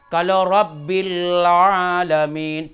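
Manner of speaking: normal talking voice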